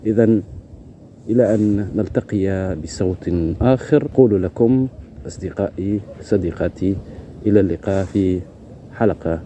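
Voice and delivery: slow, measured host outro in formal Arabic; podcast sign-off. Acted. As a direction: close the episode like a radio host, unhurried.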